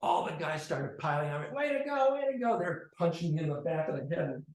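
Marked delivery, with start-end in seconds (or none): 1.6-2.6 high, loud mocking cheer; cheering taunt. Sixth-grade boys cheering as they pile on, high mocking chant.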